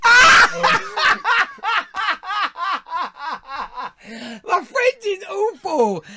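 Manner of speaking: very high, loud laughing through the words; breathless squealing laughter; laughing hysterically. Real, not acted. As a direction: crack up helplessly mid-sentence. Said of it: "I'm joking".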